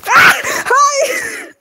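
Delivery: shrieking high in real fright; startled scream. Real, not acted. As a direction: yelp as a skeleton jumps out. Startled by skeleton.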